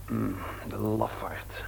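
low, slow, contemptuous under the breath; scornful mutter. Acted. Son sneering about Bert to his father, low scornful mutter. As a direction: sneer the word lafaard quietly.

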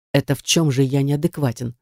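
usual speaking voice

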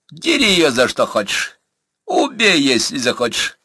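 lordly and loud; loud permission. A narrator's reading. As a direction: throw it out loudly, pitch leaping on the kill order. Master hands captive over, taunting.

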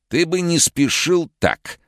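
high, slow, uncanny warning; sinister villain voice. Acted. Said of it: "посоветовал профессор".

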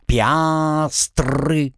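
squawked parrot cry. Acted. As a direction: shout it like a pirate parrot.

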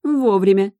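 predatory smirk. Acted. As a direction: say it with a hungry grin. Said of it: "хищно ухмыльнулась".

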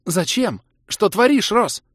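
high, loud, agitated outburst; high-pitched angry shout; furious demand. A narrator's reading. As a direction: demand it as if betrayed. Kira turns on narrator with sword.

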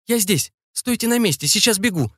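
high-pitched shouted reassurance; high, eager hollering; calling out. A narrator's reading. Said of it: "кричала Инна".

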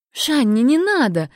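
pleading. A narrator's reading. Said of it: "взмолила она".

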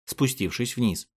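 ordinary delivery, nothing marked

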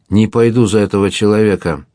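usual speaking voice

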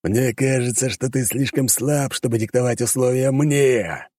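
high, slow demon voice, taunting; mocking demon. Demon mocks the hunter in his own domain, slow and sneering. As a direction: drag each word out like a scornful monster.

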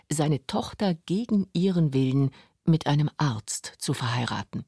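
ordinary talk, nothing marked

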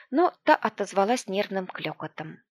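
ordinary delivery, nothing marked

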